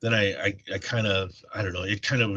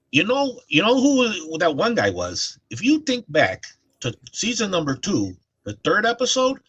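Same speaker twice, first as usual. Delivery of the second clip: put-on thick Chicago accent; 9 semitones higher, 4 dB louder than usual. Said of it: "my dad's got a real, like, thick Chicago accent".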